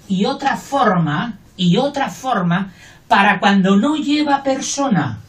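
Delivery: ordinary talk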